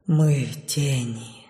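faint, slow, low whisper; as if on the last breath; dark whisper behind you. A narrator's reading. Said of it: "слаженно прошептала четвёрка ... как будто на последнем дыхании". (шёпот на последнем дыхании)

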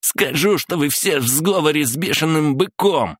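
high-pitched shouted threat; hysterical outcry. A narrator's reading. Son threatens to tell on everyone, crying out high. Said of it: "выкрикнул Николас".

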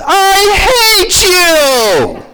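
put-on high, very slow wailing child's shout; crying child. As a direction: howl it like a four-year-old denied McDonald's. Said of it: "the child begins to cry and says".

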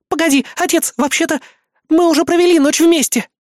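высоко и громко, зажмурившись от смущения; выпалить признание, краснея; смущённый возглас. A narrator's reading. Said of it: "смущённо воскликнула Кри". (девушка смущённо выкрикивает признание отцу)

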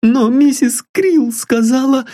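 put-on high, eager young girl pleading sweetly; a lovesick girl dreaming of a home; high girlish voice full of hope; young girl Sylvia. A narrator's reading.

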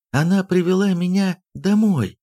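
high-pitched acted protest; crying out. A narrator's reading. Princess says the nursemaid brought her home, crying out. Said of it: "вскричала Сульдрун".

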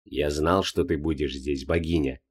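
low, soft, detached voice; detached old man. Acted. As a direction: say it flat and distant. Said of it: "безучастно произнёс Джонатан".

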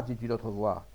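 ordinary delivery

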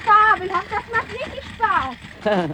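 hoch und freudig, voller Fahrspaß; begeistert. Acted. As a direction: jubel es beim Losrollen heraus. Kalle fährt auf dem Koffer. (Kalle begeistert vom rollenden Koffer, hoch und freudig)

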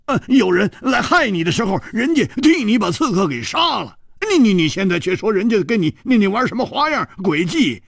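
high, loud, heated indignant outburst; agitated protest. A narrator's reading. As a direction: defend the hosts in an excited rush. 胡铁花激动质问. (激动地连声质问)

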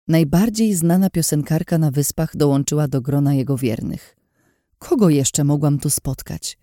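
ordinary delivery

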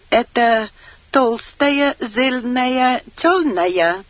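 lisped r's, high and loud; Chinese-accented Russian voice. A narrator's reading. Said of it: "осведомился китаец".

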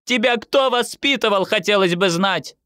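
high-pitched indignant demand; mother's outraged tirade; relentless scolding. A narrator's reading. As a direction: keep nagging, pitch held up high. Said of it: "не унималась мама".

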